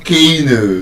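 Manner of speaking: Keno drawn out as KAY-no; wrong pronunciation. Shown as a demonstration. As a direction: stretch the first vowel of Keno slowly. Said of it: "All of them are still wrong".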